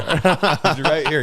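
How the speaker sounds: very slow, drawn out through laughter; laughing repeat. Real, not acted. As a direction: stretch it out while cracking up. Repeated teasing.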